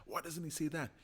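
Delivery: whispered, fretful complaint; half-whispered frustrated aside; upset muttering. Retold or imitated. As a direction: hush it like grumbling to yourself. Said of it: "I get kind of upset and go".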